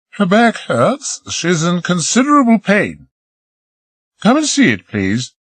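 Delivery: a hint of Marie, coaxing; lightly voiced young woman. Marie coaxing Peter to come below, lightly voiced woman.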